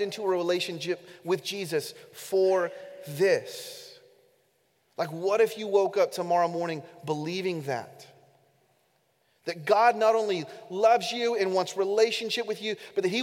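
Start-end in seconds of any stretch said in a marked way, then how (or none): none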